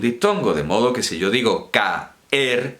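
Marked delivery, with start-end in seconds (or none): 1.7-2.8 "caer" split as ca-er, both vowels full; two syllables. Shown as a demonstration. Teacher showing there is no diphthong, saying caer in two syllables. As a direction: break "caer" into ca, then er. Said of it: "son dos sílabas tal cual".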